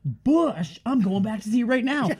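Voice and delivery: high, hyped-up promo voice; excited high voice. Pretend. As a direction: sell it like a giddy fan. Hyping the nerd movie.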